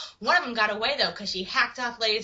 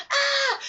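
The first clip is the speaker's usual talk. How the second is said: high-pitched mock shriek; pained scream; 12 semitones higher than usual. Acted scream of pain.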